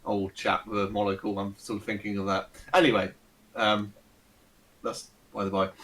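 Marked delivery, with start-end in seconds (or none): none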